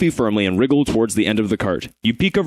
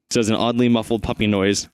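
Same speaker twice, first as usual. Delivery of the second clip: ordinary talk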